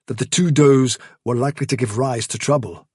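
usual speaking voice

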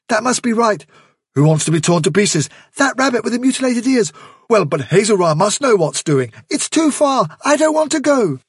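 high, anxious overlapping voices; frightened warren arguing; crowd of worried rabbits. Acted. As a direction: clamour in alarm. Rabbits talking over each other.

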